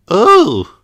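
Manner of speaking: put-on high, loud suspicious little girl; Peppa's voice. A narrator's reading. Peppa tells the wolf he isn't grandma, suspiciously. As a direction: act puzzled and a bit wary. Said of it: "she tells Danny".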